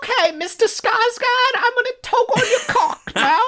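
put-on very high, sweet little-girl voice; prim doll-like child voice; Mia Goth voice. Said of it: "She's like".

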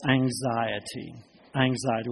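hushed. Real, not acted. Preacher repeats the word softly.

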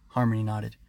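ordinary delivery, nothing marked

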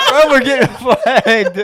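high, loud, slow laughter-filled; can't stop laughing while warning; laughing hard. Real, not acted. Cohost laughing hard at the joke, high and loud.

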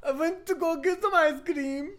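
high, loud mock Italian ice cream; mock Italian accent. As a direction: copy her Italian way of saying ice cream. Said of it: "don't know why I said it like that".

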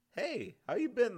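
put-on man overjoyed at meeting a boy from home, loud and high; joyful greeting. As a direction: burst out with the joy of seeing an old friend. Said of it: "the man asked".

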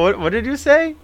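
high-pitched mock-innocent question; feigned confusion. Prankster making caller repeat the speech, feigned confusion.

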